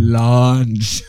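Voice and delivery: "Lunch" stretched long and loud with a doubled L; drawn-out double L. Pretend. Riffing on two L's in lunch, drawn-out "Lunch". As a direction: goofily hold and belt out "Lunch". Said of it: "Put two L's in lunch".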